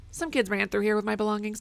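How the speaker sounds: normal talking voice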